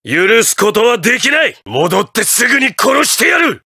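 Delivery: high, rising angry shout; furious threat. Acted. President vowing to kill his foe, furious and rising. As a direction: build into an enraged threat.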